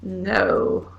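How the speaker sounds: ordinary delivery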